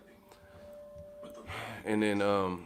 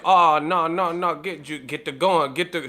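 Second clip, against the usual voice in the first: imitating a Southern manager chasing out a crackhead; 6 semitones higher, 6 dB louder than usual. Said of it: "she sound like Otis and shit from Martin".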